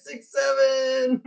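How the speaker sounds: high, slow sing-song six seven; silly six seven. Acted. Streamer repeating the meme, high and slow. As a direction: stretch it out goofily up high. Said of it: "What do you call an ill seven?".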